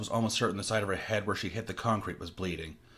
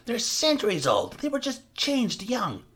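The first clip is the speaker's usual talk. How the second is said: much higher, whining and wounded; high petulant voice of the captor; whiny Carl; 3 dB louder, slower than usual. Acted. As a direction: plead like a hurt manchild. Carl defending figures, high.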